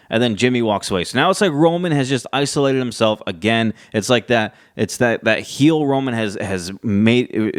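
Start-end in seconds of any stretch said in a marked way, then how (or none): none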